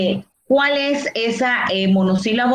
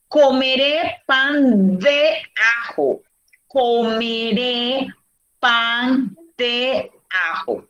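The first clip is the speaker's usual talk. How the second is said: word by word, very slowly; slow example reading; slower than usual. Shown as a demonstration. Teacher giving students an example sentence, slow and spaced out. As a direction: read it out slowly, pausing between words.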